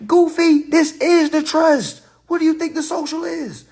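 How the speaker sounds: loud, high scold; exasperated loud scolding; mocking outburst. Real, not acted.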